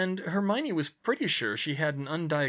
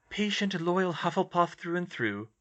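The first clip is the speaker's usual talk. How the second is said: put-on lightly raised girl voice; young woman's wry thought; Hermione voice; 7 semitones higher than usual.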